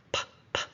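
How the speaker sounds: P as a bare unvoiced pop; the sound P alone, not the letter name; isolated sound. Shown as a demonstration. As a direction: pop P twice with a gap. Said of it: "That's a P".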